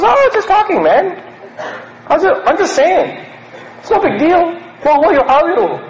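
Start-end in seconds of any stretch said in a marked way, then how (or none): 0.0-4.6 high laughing brush-off; laughing dismissal. Retold or imitated. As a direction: laugh it off, much higher. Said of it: "He goes".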